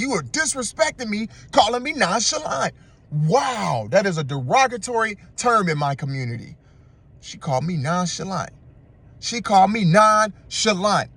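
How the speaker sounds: high-pitched, theatrical affront; mock offended. Man reacting to being called nonchalant, mock offended indignation. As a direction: act scandalised by an insult, hammed up. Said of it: "That's how he responds".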